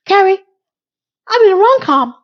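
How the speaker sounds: high, loud giddy squeal; ecstatic squeal. Retold or imitated. Miranda tells Carrie she's in a rom-com, giddy squeal.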